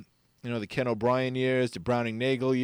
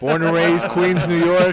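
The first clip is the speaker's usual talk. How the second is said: proud declaration; 4 semitones higher, 9 dB louder, slower than usual. Real, not acted. Proudly stating his hometown.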